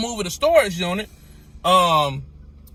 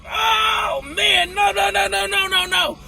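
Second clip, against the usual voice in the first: panicked denial; 11 semitones higher, slower than usual. Retold or imitated. Retold panic after injury.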